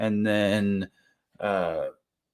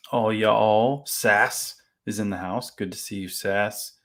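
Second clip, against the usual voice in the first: ordinary delivery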